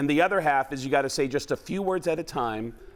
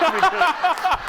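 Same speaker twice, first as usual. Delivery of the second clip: panting gasp; 8 dB louder than usual. Shown as a demonstration. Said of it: "out of breath Mark Wahlberg".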